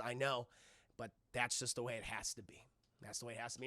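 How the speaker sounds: soft, low murmur; acted quiet sorrow; tender resignation. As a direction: say it low and hushed, letting go. Elisa gently silencing Goliath.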